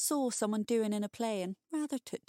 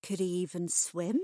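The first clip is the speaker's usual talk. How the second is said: ordinary delivery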